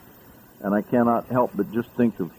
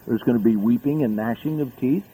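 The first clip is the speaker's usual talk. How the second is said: ordinary delivery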